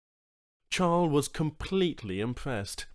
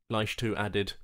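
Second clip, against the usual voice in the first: plain reading voice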